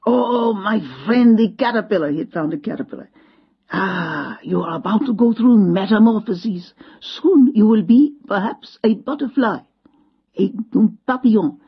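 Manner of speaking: put-on old Frenchman marvelling at a caterpillar, French accent. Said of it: "his long French nose".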